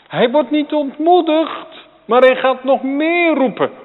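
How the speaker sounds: high and loud, insistent; fervent preaching. Acted. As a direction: drive it home with full force.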